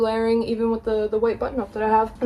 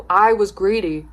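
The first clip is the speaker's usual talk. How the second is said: ordinary delivery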